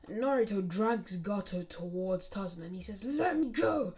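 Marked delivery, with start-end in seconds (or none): 3.0-3.9 high, loud demand; protesting captive. Acted. Gato dragged towards Tazuna, loud high protest. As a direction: burst out loudly, pitched well up.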